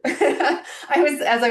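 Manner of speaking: words through a light laugh; real giggle as she starts talking. Real, not acted. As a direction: still giggling from the cheer. Laughing after her cheer.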